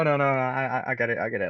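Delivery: ordinary delivery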